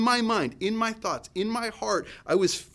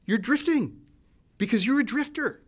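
higher, urgent call to wake up; a firm but kind voice rousing a dreamer; loving divine nudge, raised and bright; God's wake-up call; 7 semitones higher, 3 dB louder than usual. Retold or imitated. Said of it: "It was like".

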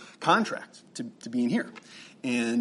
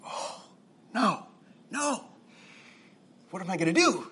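high-pitched, hammed-up dismay; mock dismay; 6 semitones higher than usual. Driver whose van won't start, mock dismayed. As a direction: play up a little panic in retelling.

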